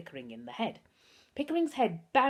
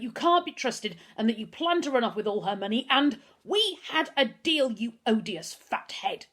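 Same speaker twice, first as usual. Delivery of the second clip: loud, higher, furious tirade building to a shriek; screaming; 5 semitones higher, 6 dB louder than usual. A narrator's reading. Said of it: "Pickering started screaming".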